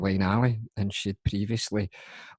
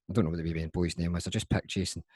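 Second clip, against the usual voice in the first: usual speaking voice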